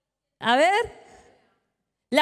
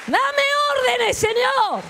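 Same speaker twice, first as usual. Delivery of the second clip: cried-out prayer; 7 semitones higher, slower than usual. Acted. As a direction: cry out to God with full force. Preacher crying out prayer. (clamor fuerte y agudo, "Dame órdenes, Señor")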